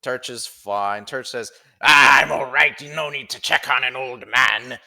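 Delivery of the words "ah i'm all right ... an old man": put-on loud, cheery old geezer; old man voice. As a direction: boast like a rowdy old man. Turch boasts, old fishmonger.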